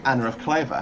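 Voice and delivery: Cleves said German-style, as "KLAY-vuh"; original German pronunciation. Shown as a demonstration. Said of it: "couldn't pronounce Cleves, apparently".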